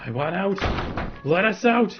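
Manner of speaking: from a slow demand to a loud high scream; building to a scream. Acted. Monster pounding the door, its demand building to a shriek. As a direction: let it burst into a howl.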